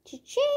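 high sung cha-ching like a till; cash register cha-ching. Pretend. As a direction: ring up the sale in a high voice. Cash register after payment.

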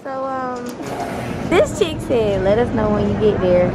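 muffled through cloth mask; speaking behind fabric covering; through a face mask. Real, not acted. Seen 5: Patterned cloth mask covers mouth and nose throughout the labelled words.

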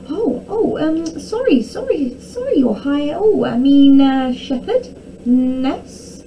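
high, stumbling apology; flustered stutter. A narrator's reading. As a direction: fumble nervously for the right title. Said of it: "stuttered the man".

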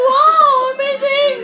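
very high, slow gasping awe; awed wow. Retold or imitated. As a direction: gush like you're dazzled. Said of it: "You're like".

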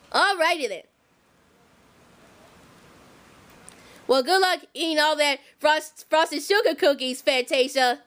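deep motherly warning; Harriet voice. Pretend. Harriet voice again.

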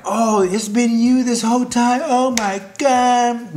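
high, hammed-up gushing disbelief; mock amazed discovery. Crush finding out who sent the package, mock amazement. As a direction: overact finding out, stretching the last words. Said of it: "once they find out, they're like".

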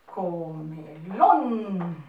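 Comelón stretched out very slowly; slow dictation; slow and clear. Shown as a demonstration. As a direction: draw out Comelón for the kids. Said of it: "Ese sería el sinónimo".

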